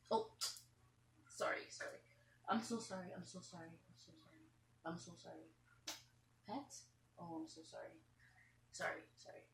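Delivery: quiet, almost whispered sorry; softly whispered apologies to the bird; soft soothing apology. Real, not acted. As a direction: hush and soothe a scared bird. Comforting fallen bird softly.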